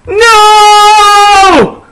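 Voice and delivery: high loud drawn-out scream; hammed-up horrified shriek; long theatrical no. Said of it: "I screamed".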